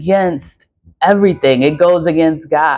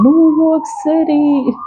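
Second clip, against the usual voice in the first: calling out; 10 semitones higher, slower than usual. Real, not acted. Calling out the city.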